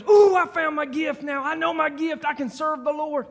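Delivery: high, loud, eager Ooh; mock thrilled church member; a hammed-up joyful discovery; excited gift finder. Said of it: "one time somebody goes".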